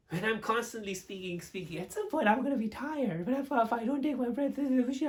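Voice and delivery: high, running words together without a breath; breathless nonstop mumbling. Shown as a demonstration. Coach showing what happens without breathing, nonstop mumbling. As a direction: babble on until the words blur. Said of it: "I was just mumbling".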